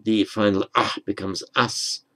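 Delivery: ordinary talk, nothing marked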